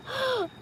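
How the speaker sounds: sharp high teary gasp; sudden choked gasp, suddenly overcome; emotional gasp. Real, not acted. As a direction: catch your breath as tears come.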